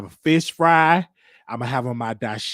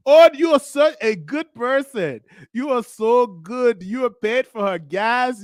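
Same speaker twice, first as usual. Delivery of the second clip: higher, louder mock praise, slowed; gushing attendant; 5 semitones higher, 5 dB louder than usual. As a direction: gush over him like an impressed clerk. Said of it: "the guy at the gas station telling me".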